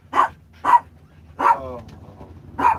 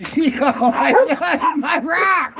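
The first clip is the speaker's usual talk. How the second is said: high, loud, slow laughing shout; laughing squeal; 14 semitones higher, 8 dB louder, slower than usual. Real, not acted. Owner voicing the dog's lost rock, laughing.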